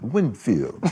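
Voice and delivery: mimicking a clipped English accent on Winfield; a light English-accented Winfield. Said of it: "he said it kind of in a, uh, English accent".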